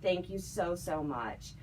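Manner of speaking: ordinary talk, nothing marked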